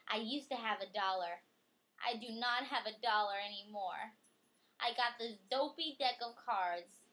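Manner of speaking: lively storybook reading voice; reading to a child. Acted. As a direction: read it out brightly for a child. Reading picture book aloud.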